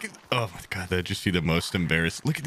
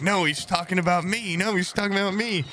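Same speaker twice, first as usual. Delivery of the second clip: mock chat; 9 semitones higher than usual. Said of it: "everyone in chat would be like".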